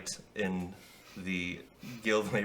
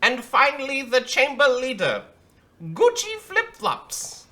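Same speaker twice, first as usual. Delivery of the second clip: Bushel announcing; 6 dB louder than usual. Acted. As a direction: proudly announce the final guest. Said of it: "Bushel goes".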